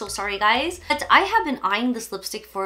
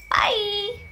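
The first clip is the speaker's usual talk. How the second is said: high cheery bye; 10 semitones higher than usual. Real, not acted. Cheery farewell.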